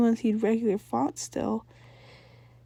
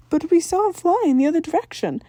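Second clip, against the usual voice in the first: high, loud puzzled mom; cartoon mom objecting; 8 semitones higher, 7 dB louder than usual. A narrator's reading. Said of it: "she said".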